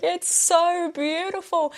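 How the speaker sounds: high, slow, teary wail of "so beautiful"; hammed-up tearful sigh over a film; mock ugly crying. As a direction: gush it out like sobbing over cookies. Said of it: "I'm ugly crying over these cookies".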